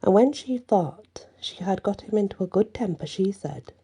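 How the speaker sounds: ordinary delivery, nothing marked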